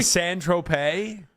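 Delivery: Saint Tropez said very slowly and tentatively, ending tro-pay with a questioning rise; slow unsure Saint Tropez, z silent; on request. Shown as a demonstration. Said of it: "Can you say it one more time for me?".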